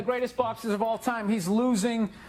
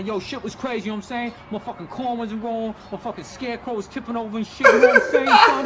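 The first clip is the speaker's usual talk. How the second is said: mock gangster farm kid; 7 dB louder than usual. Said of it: "all hardcore about your crop".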